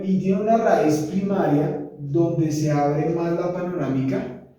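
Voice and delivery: normal talking voice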